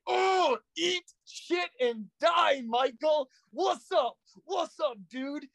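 high-pitched, crowing taunt; mock gloating. Retells inner gloating at his brother.

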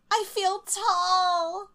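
high, slow and smug; mock lofty. The contestant gloating after the announcement, slow and lofty. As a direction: stretch it out proudly. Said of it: "Kelsey's like".

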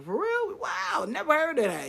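high-pitched, put-on wonder; feigned amazement. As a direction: humor a chattering child with mock surprise. Feigning interest in child's chatter.

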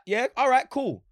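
high, loud laughing taunt; mocking bravado. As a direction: taunt them, half laughing. Mock challenge to Twitter haters.

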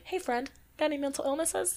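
soft, high, sugary question; saying it nicely. Pretend. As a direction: ask it sweetly and lightly. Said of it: "but I say it nicely".

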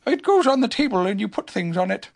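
high-pitched bear voice; mild-mannered host, pitched high; Pooh. A narrator's reading. Pooh tells his night visitor the plan, high voice. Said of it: "said Pooh".

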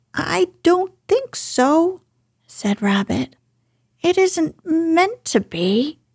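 Rabbit faking a stranger's voice, pitched high; disguised Rabbit voice. Acted. Rabbit fibs to Pooh from the hole, high disguised voice. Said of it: "in a different sort of voice".

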